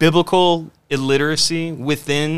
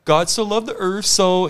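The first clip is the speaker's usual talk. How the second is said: higher, hesitant mock mumble; vague churchgoer; 5 semitones higher than usual. Mocking churchgoers who can't explain the gospel, higher vague voice. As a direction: play a clueless churchgoer fumbling, pitch up. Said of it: "they're just like".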